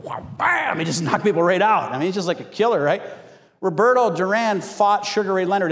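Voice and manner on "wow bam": a high burst of wow, bam; playful boxer's bam; mock punch noise. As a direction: throw the punch with your voice, high and sudden. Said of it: "he's like".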